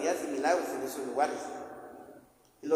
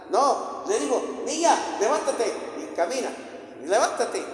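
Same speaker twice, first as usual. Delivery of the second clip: raised, emphatic preacher's command; acted command; 6 semitones higher, 4 dB louder than usual. Jesus to the dead girl, acted commanding call. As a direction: order the dead to rise with authority.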